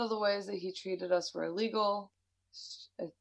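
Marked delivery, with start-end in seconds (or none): 2.5-3.1 quiet aside. Real, not acted. Aside clarifying to listeners.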